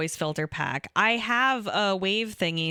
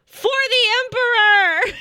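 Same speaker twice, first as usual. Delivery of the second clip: hammed-up yell, high and loud; space marine battle cry; 11 dB louder than usual. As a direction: shout it like a war cry, laughing. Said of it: "is that good? How's that?".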